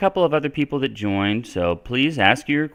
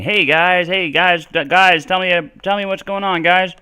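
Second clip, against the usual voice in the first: higher, louder self-imitation hailing the group; mock-eager call to the guys, pitched up; 8 semitones higher, 4 dB louder than usual. Shown as a demonstration. Demonstrating the bad habit to viewers, high calling-out voice. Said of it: "lifelong bad habit of saying".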